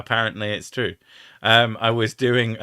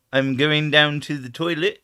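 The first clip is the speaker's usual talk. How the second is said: ordinary talk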